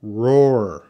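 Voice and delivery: "Roar" loud and drawn out, falling; both R's sounded. Shown as a demonstration. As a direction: ROAR stretched, strong R at start and end. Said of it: "two R's".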